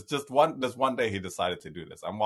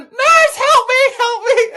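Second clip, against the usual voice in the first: high, loud imitated old-lady shriek for the nurse; mock panicked cry for help; 15 dB louder, slower than usual. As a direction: shriek it like a terrified grandma. Grandma hits nurse button.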